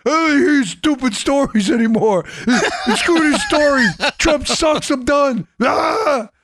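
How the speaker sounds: high, whiny mock tantrum; mock upset. Host imitates angry emailer fed up with stories, mock tantrum. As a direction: throw a hammed-up, high-pitched fit. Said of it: "so upset".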